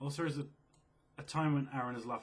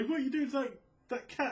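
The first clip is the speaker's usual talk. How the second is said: much higher and louder, an appalled granny; shocked old woman; 13 semitones higher, 6 dB louder than usual. Retold or imitated. An old woman scolds the killer over the cat, high and shocked. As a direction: squeal it like a horrified old lady. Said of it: "As if to say".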